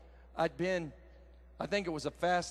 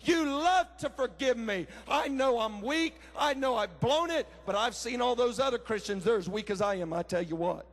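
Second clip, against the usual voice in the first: fervent preaching; 6 semitones higher than usual. Acted. Preacher urging the congregation to trust God's mercy, fervent preaching. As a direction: preach it urgently, stretching the key phrases. Preaches a prayer at full force.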